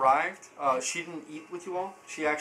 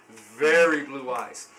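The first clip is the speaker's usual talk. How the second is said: usual speaking voice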